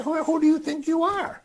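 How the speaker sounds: mock the crazy claim, dismissive; scornful crowd. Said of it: "But they say".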